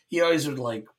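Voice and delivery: normal talking voice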